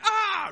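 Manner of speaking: high comic "aah" scream; short hammed-up yell of a man flung away; mock falling scream. Knight is cast into gorge.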